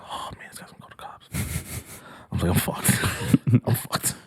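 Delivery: whispered panicked thought; inner whisper. Retold or imitated. Teller thinking the tow guy will call cops, whispered. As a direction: whisper it to yourself. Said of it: "This is exactly going through my head".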